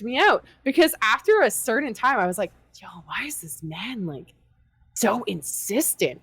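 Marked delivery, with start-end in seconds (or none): 2.8-6.2 susurro áspero y lento, casi sin voz; whisper. Retold or imitated. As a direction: whisper it like a secret aside, slowing on the end. Inner thought about a pushy character.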